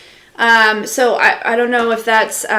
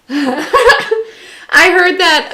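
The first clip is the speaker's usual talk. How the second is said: laughing; 7 semitones higher, 5 dB louder, much slower than usual. Real, not acted. Laughing at a viewer's comment.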